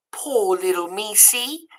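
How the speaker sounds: slow, soft, high coo; Meg's pity. Acted. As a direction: pity the mouse, slow and hushed. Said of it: "she said".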